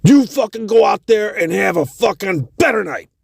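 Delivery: high, boisterous encouragement; generous rocker. Retold or imitated. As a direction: push the gift on him. [name] hands over money.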